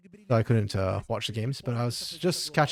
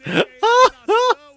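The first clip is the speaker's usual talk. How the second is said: high, loud laughing fit; real burst of laughter; 14 dB louder than usual. Real, not acted. Cracking up at a random move, loud high laughter. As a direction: crack up loudly. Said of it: "it cracks me up".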